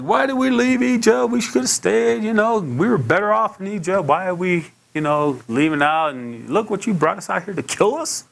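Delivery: high-pitched panicky complaining; mock freaking out. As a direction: imitate a crowd whining in alarm. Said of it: "oh, freaking out".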